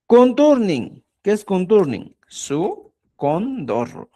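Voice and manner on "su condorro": "Su condorro" slowly, in separated pieces; syllable by syllable. Shown as a demonstration. Teacher answering what conturning is, syllable by syllable. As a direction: draw out each part of "Su condorro".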